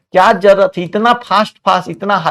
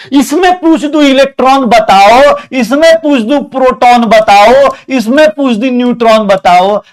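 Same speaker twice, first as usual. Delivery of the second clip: raised, louder, rapid-fire questioning; loud quizzing; 5 semitones higher, 5 dB louder than usual. Acted. Teacher loudly quizzing his students, high and forceful.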